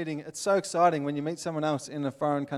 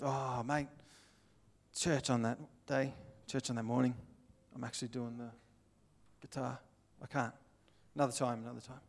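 low, soft mumbled apology; reluctant excuse; 4 semitones lower, 9 dB quieter than usual. Acted. Churchgoer turns down a Sunday meet-up, low and apologetic. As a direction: beg off quietly, voice dropped.

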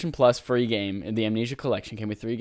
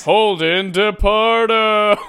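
booming, high, slow name callout; hammed-up late-night show intro call; SNL announcer voice; 9 semitones higher, 9 dB louder, much slower than usual. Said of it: "S N L voice".

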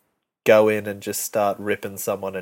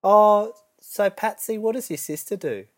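high, chirpy gossip voice, pitched way up; mimicking a middle-aged woman; 11 semitones higher than usual. Coworker imitating the nosy office woman, high and prim. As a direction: put on a prim office-lady voice. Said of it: "it was something along the lines of".

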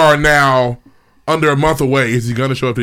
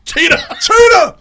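shouting; 5 dB louder, slower than usual. Pretend. Joining chant of cheater.

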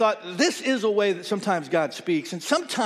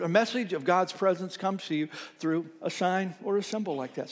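ordinary talk, nothing marked

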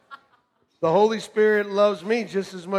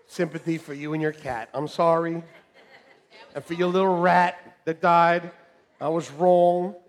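normal talking voice

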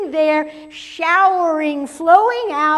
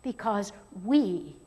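usual speaking voice

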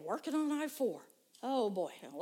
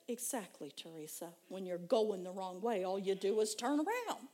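soft, calm, lower reassurance; gentle Holy Spirit; 4 semitones lower, 3 dB quieter than usual. Retold or imitated. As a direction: reassure her gently and quietly. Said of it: "the Holy Spirit said".

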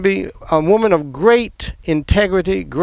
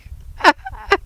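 high laugh; 10 semitones higher than usual. Real, not acted. Laughter after retold shock.

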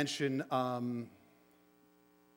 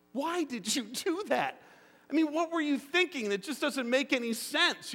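puzzled parent; 10 semitones higher than usual. Retold or imitated. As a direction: ask your son like an exasperated parent. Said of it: "you know what every parent asks".

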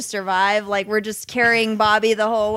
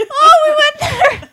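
laughing; 10 dB louder than usual. Real, not acted. Laughing fit after dark joke.

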